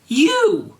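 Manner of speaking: high, loud accusing yell. Acted. Draco recognizes Garfield, high shouted accusation. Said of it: "yelled Draco".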